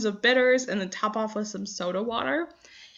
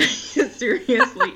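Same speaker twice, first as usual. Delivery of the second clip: ordinary delivery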